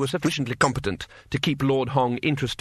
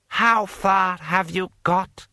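slow, higher, imperious questioning; impatient lord; 7 semitones higher, 3 dB louder, much slower than usual. A narrator's reading. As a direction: demand a progress report with measured impatience.

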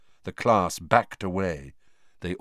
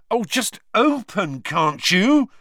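loud, higher, frustrated plea; angry; 12 semitones higher, 6 dB louder, slower than usual. A narrator's reading. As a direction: snap at the stubborn statue. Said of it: "he said angrily".

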